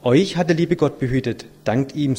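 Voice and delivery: ordinary talk, nothing marked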